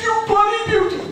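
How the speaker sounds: very high, elated whoop; jubilant outburst. Acted. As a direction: act out a winner's joy, voice leaping up. Publican hears he won lottery.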